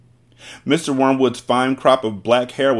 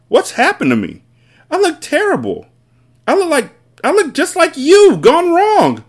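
very high, loud, horrified wail; father aghast, voice pitched right up; yelling; 6 dB louder than usual. A narrator's reading. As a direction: build to a full panicked yell. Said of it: "yelled the father".

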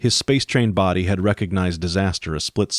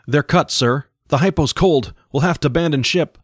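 higher, louder frightened shout; panicked mate; 8 semitones higher, 3 dB louder than usual. Acted. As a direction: report disaster in panic. Mate panics over the audio.

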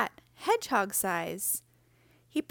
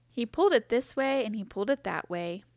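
plain reading voice

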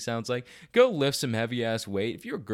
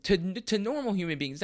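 usual speaking voice

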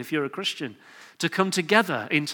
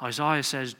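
usual speaking voice

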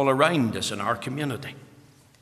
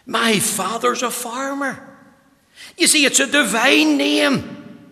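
raised, ringing pulpit voice; preaching at full force; 6 semitones higher, 5 dB louder than usual. Acted. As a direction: preach it out loud and high. Opening of sermon, full force.

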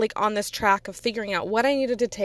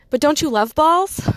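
blurted quote; 5 semitones higher, 5 dB louder than usual. Retold or imitated. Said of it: "I'm like".

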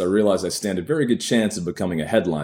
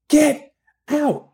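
much higher, louder barked "Get out!"; barked shout; 6 dB louder than usual. A narrator's reading. Woman ordering him out of her bed, high angry shout. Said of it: "her voice barks".

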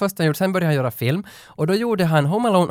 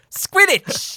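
mock wizard shout. Mock Harry Potter shout.

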